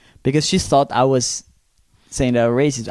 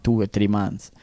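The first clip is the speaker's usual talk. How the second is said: normal talking voice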